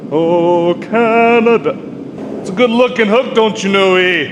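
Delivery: high, loud hammed-up Canadian accent, eh; mock Canadian accent. Blacksmith admiring a hook in a joke Canadian accent. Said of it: "butcher, like, a northern Minnesota and Canadian accent".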